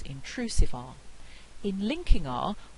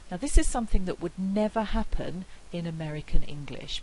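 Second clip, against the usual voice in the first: normal talking voice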